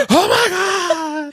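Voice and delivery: very high, drawn-out mock scream; mock terrified scream after a gasp; imitated scream. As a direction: stretch it into a hammed-up falsetto shriek. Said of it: "because you screamed so loud".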